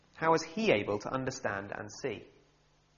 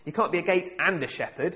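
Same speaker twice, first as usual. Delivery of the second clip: a hammed-up gotcha, louder and higher; mock pedantic shout; 4 semitones higher, 5 dB louder than usual. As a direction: play a smug nitpicker pouncing on a mistake. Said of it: "get his reading glasses out and shout".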